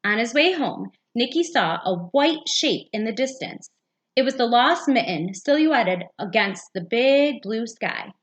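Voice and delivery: ordinary delivery, nothing marked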